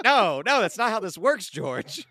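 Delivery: George Bush impression. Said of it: "your George Bush impression".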